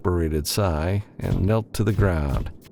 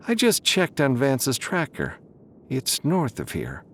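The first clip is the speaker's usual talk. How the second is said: higher voiced Sharon update; Sharon, reporting; 7 semitones higher than usual. A narrator's reading. Sharon tells Matthew where Vance is, higher. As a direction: report it briskly.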